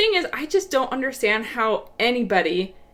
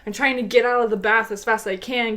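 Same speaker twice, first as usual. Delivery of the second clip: usual speaking voice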